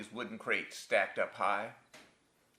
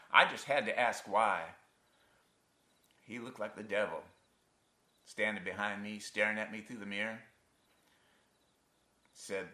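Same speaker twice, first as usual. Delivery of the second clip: normal talking voice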